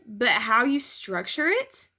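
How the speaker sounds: ordinary delivery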